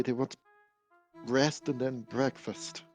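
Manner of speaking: normal talking voice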